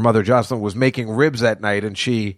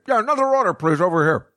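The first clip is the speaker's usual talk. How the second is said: high, chirpy voice calling for more food; higher-pitched mock customer calling out orders; mock diner ordering; 7 semitones higher, faster than usual. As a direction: call the waiter like a greedy glutton. Imagined himself ordering at restaurant.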